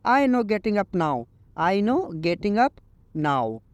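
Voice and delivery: very slow, each English word laid out clearly; slow teaching read. Shown as a demonstration. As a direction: model the sentence slowly for learners. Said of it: "आप उसको English में बोलो".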